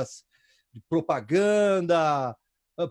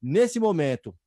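ordinary delivery

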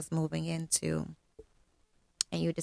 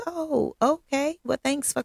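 imagined understanding reply, pitched way up; high-pitched agreeable reply; 8 semitones higher, 5 dB louder than usual. Acted. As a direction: act out a pleasantly receptive high voice. Imagined accepting reply to friend.

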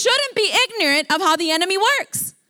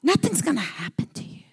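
softer, whispered temptation; devil's whisper; 5 dB quieter, faster than usual. Retold or imitated. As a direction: breathe the lie into their ear. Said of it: "That's how he talks".

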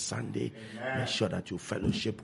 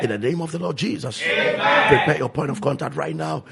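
ordinary talk

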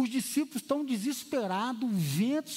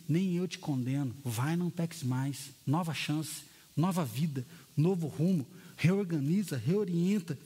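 gentle forgiving Jesus; 7 semitones lower than usual. Acted. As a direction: release her gently. Jesus forgives the accused woman. (Jesus perdoando, voz baixa e suave)

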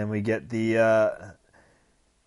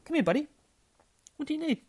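talking to a dog; much faster than usual. Real, not acted. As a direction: coo gently to your dog.

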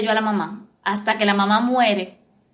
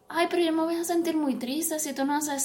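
guilt-tripping mother; 5 semitones higher, 7 dB quieter than usual. Retold or imitated. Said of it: "las manipulaciones vienen desde".

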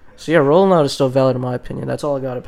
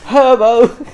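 put-on British accent; 8 semitones higher, 9 dB louder than usual. Joking about a British [name], mock British accent. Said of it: "If you're British".

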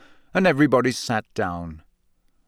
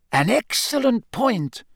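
put-on higher-pitched, measured old headmaster; genial headmaster; 11 semitones higher than usual. Headmaster asking Hagrid about the shouting, courteous. Narrator voices Dumbledore.

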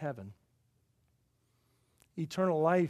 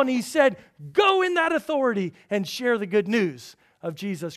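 loud, high, emphatic sermon delivery; full-force preaching; 6 semitones higher, 11 dB louder than usual. Real, not acted. Preacher proclaiming the authority of Jesus, loud and forceful. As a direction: proclaim it to the whole congregation.

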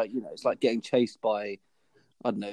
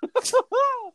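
sudden high squeal of a laugh; real laughter, much higher; high burst of laughter; 4 dB louder than usual. Real, not acted. Hearing blurt as flirt.

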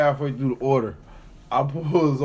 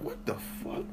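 whispered aside; 11 semitones higher, 12 dB quieter, slower than usual. Retold or imitated. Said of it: "I'm like".

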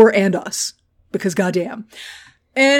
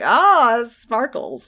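Grandmaster; 2 semitones higher, much slower than usual. Retold or imitated. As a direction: tease with a drawn-out, amused ruler's voice. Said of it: "And the Grandmaster's like".

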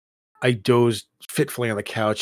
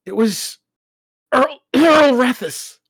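slow, high, loud dread; name him slowly in dread; fearful naming; 14 semitones higher, 8 dB louder, slower than usual. Acted. Son naming attacker.

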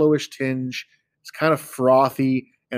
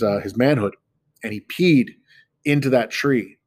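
normal talking voice

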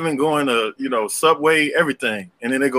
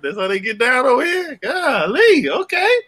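retold disbelief climbing higher and higher; taken aback; 9 semitones higher than usual. Man recalls looking at her apartments with his friend, incredulous. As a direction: act out amazed reaction, pitch rising to a high okay.

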